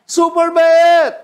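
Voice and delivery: slow, loud, high exclamation; shouted praise. Acted. Preacher exclaiming God's kindness to the church, loudly. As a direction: shout it out to the crowd.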